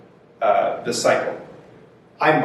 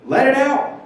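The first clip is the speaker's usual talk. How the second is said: voicing a supportive friend, pitched way up; high encouraging voice; 12 semitones higher than usual. Therapist imitating someone urging an angry person, high encouraging voice. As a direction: cheer them on to let it out, high and bright-voiced. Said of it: "Rather than somebody saying".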